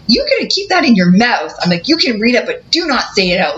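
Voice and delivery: high, firm scolding command; angry warning. Retold or imitated.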